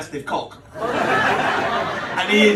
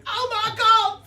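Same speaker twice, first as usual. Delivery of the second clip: high-pitched mock shriek from upstairs; wife's scream; slower than usual. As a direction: shriek it like a shocked wife. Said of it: "I heard her scream".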